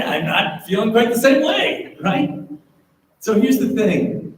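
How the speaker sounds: ordinary talk, nothing marked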